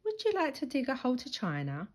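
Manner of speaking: quick honeyed temptation; sweetly. A narrator's reading. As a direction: sell the idea sweetly. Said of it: "said Henry sweetly".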